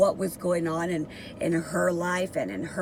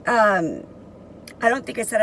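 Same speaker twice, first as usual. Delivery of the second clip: ordinary talk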